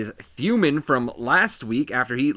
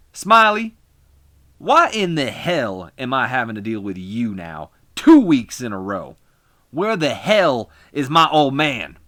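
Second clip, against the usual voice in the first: angry wrestler promo; 2 semitones higher, 7 dB louder, slower than usual. Acted. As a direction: bark it into the mic like a furious heel. Jarrett yells at the manager.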